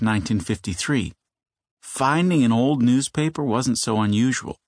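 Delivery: ordinary delivery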